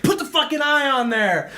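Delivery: sister imitated high and laughing; mock furious. Sister Kirsten angry over a text, mock furious. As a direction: play the annoyed sister's demand. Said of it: "She gets fucking furious".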